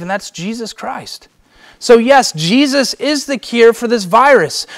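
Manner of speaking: loud, high, urgent sermon voice; preaching at full force. Acted. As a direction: press the point on the listeners. Preacher's urgent appeal about the virus.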